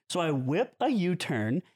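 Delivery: normal talking voice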